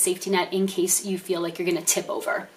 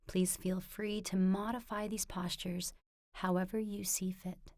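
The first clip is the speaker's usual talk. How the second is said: much softer, slightly lower meditation voice; guided meditation; 4 semitones lower, 16 dB quieter than usual. Acted. Teacher leading viewers into a standing mindful movement practice, soft guided meditation. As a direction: guide them gently, hushed, with long pauses between phrases.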